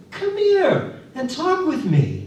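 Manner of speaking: put-on high, friendly old man's call; beckoning old man. A narrator's reading. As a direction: invite the drunk over like a kindly grandfather. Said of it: "beckoning to the drunk".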